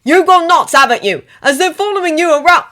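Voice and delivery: loud heated rant; angry shouting. Acted. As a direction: spit out every grievance. Ron and Harry quarrelling.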